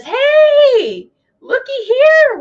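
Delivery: hammed-up gleeful announcement; mock cruise line. Norwegian mocked as gleefully announcing its exit, high and loud. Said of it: "Norwegian says".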